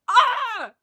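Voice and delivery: high wailed "ah" of delight; ecstatic cry. Real, not acted. Host overcome by the song, ecstatic cry.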